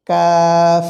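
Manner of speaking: কাফ as a light, long "kaaf"; কাফ drawn out with a plain front k; easy letter sound. Shown as a demonstration. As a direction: hold the open "aa" in কাফ. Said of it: "একেবারেই সহজ".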